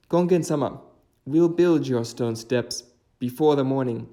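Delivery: boastful demons. Acted. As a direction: answer the challenge confidently. Demons accept the challenge.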